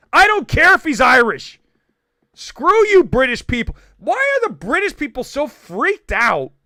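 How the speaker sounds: loud, high-pitched exasperation; comic rant. Real, not acted. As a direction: go off on an indignant tirade. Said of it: "There's [name] going on a rant".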